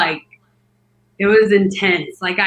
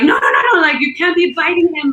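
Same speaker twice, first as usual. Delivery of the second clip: raised, louder rush of protest; higher-pitched mock alarm; urgent scolding; 6 semitones higher, 4 dB louder, faster than usual. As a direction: imitate people frantically telling someone off. Others urgently stop her biting.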